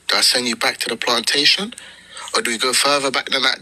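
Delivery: ordinary talk, nothing marked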